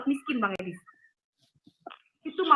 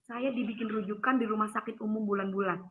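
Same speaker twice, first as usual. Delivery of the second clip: usual speaking voice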